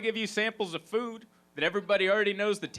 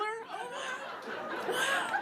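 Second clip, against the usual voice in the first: mock amazement; 6 dB quieter, slower than usual. Amazed at plain mac and cheese.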